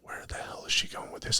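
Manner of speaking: hushed whisper aside. Retold or imitated. Shep leaning over to whisper to his friend, a hushed aside. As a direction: lean in and whisper it under your breath. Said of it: "Shep leans in and says".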